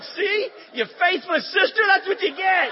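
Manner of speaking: loud, high-pitched scolding; comic, triumphant shouted reproach; mock rebuke. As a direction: shout a hammed-up telling-off.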